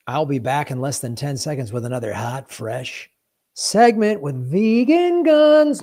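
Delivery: rising hype into the next segment; announcer voice. Acted.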